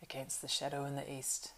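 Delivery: soft, grave completion of the thought; quiet. A narrator's reading. Strider finishing Butterbur's sentence, quiet. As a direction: say it quietly, well under the voice. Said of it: "said Strider quietly".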